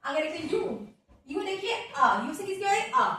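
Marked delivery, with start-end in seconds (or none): none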